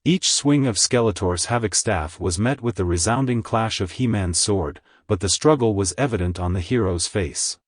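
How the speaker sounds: usual speaking voice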